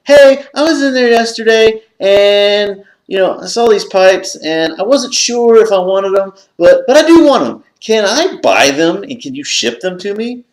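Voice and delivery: high, anxious rush of a request; nervous eager retelling. Retold or imitated. Calling the antique store, a high nervous eager plea. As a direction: plead politely to buy the pipes. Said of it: "I'm like".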